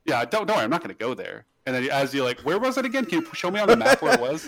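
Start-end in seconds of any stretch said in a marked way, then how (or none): 2.4-4.5 high, sweet feigned innocence, laughing; mock innocent. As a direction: ask as if you'd never go there. Sneaky request for directions.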